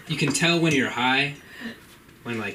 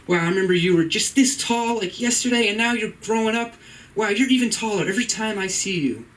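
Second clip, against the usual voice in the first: higher, doting mom voice; imitating his mom; 8 semitones higher than usual. Son voicing his mom marveling at him, higher. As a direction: marvel like a proud mother. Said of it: "she could be like".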